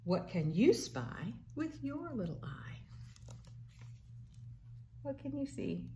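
unvoiced hushed question; whisper. A parent whispering the game question to a toddler. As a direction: lean in and whisper it.

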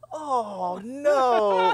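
high mock-dismayed oh no; dismayed cry. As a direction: wail it in dismay at the dead squirrel. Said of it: "I was like".

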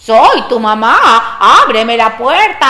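loud, slow, swooping falsetto; wolf posing as mother. Acted. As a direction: sing it out as a fake mum. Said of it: "la voz le salió diferente".

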